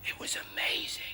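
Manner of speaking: hushed, unvoiced amazement; awed whisper. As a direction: whisper it as a marvel. Awed confiding quote, whispered.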